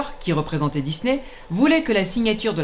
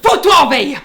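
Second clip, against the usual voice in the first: loud, high snapped order; angry command; 8 semitones higher, 8 dB louder than usual. Acted. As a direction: yell the command at the machine. Shouting order at machine. (ordre crié avec colère)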